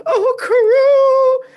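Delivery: high wailing cry of the duck's name; mock crying Vivi. Retelling the anime, wailing for her fallen duck. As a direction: ham up a tearful princess wail.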